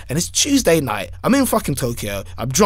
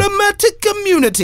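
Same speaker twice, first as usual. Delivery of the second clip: mock proclamation. Joking claim of immunity.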